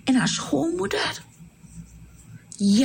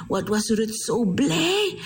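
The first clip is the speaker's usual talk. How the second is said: usual speaking voice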